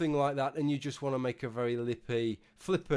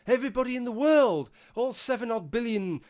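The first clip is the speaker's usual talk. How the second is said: higher and louder, hammed-up emphasis; exaggerated exclaim; 7 semitones higher, 4 dB louder than usual. Real, not acted. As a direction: blow it up like it's obvious to the whole planet. Exaggerating how obvious sarcasm is.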